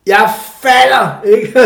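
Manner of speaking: high, loud, drawn-out climber's yell; acted warning shout. Climber to his partner below as he falls, acted shouted warning. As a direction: yell it down the rock face. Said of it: "så råber, så, øh, råber man ned til sin kammerat".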